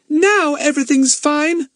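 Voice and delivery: very high, loud, stretched delight; overjoyed child. Acted. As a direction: exclaim it as a thrilled child. Children overjoyed at friend's arrival.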